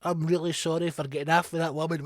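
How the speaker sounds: lightly voiced sheepish minister; hint of a grovelling politician; mock contrite politician; mock apology.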